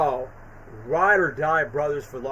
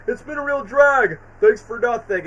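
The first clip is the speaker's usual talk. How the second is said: higher, louder Eggman send-off; Eggman impression; 5 semitones higher, 6 dB louder than usual. Fan quotes Eggman's final send-off line, in a higher, louder mock villain voice. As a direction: ham up the villain's farewell. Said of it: "Jim's like".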